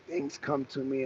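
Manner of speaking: ordinary delivery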